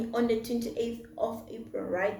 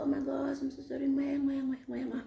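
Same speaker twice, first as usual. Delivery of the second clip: tender apology; 2 semitones higher, 5 dB quieter than usual. Retold or imitated. Apologizing, kissing baby daddy.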